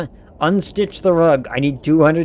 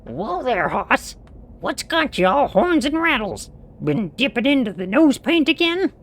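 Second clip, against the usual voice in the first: high cowboy twang, much higher pitch; high-pitched Western cowboy voice; cowboy drawl; 8 semitones higher than usual. Acted. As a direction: play a rowdy old-west cowpoke. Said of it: "[name] doesn't talk like that".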